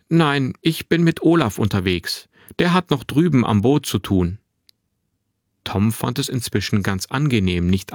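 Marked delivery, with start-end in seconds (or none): 0.1-4.4 put-on higher, lively boyish voice; chatty young boy. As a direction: answer like an open, talkative boy. Narrator voices the boy Finn.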